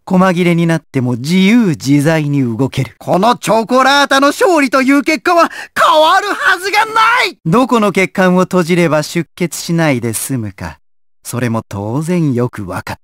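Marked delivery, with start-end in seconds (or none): 3.0-7.4 rising villainous boast, climbing to a high cry; triumphant shout. Acted. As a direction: build to a high, gloating shout. Villain boasts of certain victory. (勝ち誇った高い叫び)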